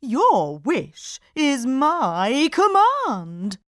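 slow, loud grand genie; solemn obedient genie; booming genie. A narrator's reading. Genie continues.

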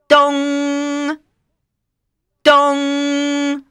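long even bell tolls; clock chime imitation. A narrator's reading. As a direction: ring out like a clock. Clock striking midnight.

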